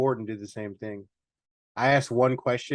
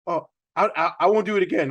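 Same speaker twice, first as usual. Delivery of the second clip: higher, louder pleading voice; pleading employee; 5 semitones higher, 5 dB louder, faster than usual. Retold or imitated. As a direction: beg to keep the job.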